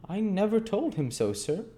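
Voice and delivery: put-on high-pitched child voice in amazement; amazed little boy. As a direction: protest in astonishment as a small boy. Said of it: "returned Oliver in amazement".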